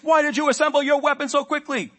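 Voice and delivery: high, loud drill sergeant bark. Retold or imitated. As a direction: yell it right in his face. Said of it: "the drill sergeant's voice".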